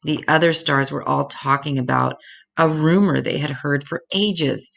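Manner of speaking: plain reading voice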